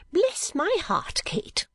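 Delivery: high, drawn-out startled exclamation; sudden outcry. A narrator's reading. Mother suddenly noticing Kate's colour, startled outcry. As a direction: burst out in high-pitched surprise. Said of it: "with her wonted suddenness".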